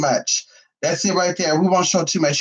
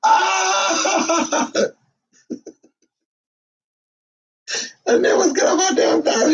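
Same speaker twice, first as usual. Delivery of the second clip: laughing, much higher squeals; real laughter; 14 semitones higher, slower than usual. Real, not acted. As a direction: crack up mid-word. Laughing and shouting.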